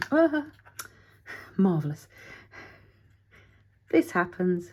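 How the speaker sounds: exasperated mock delight; sarcastic. Real, not acted. Glue blob mishap.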